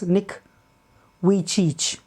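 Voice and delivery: Vujicic said as "wee-cheech"; preferred pronunciation. Shown as a demonstration. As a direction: say Vujicic with a W start, falling at the end, "wee-cheech". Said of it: "Nick himself introduces him as".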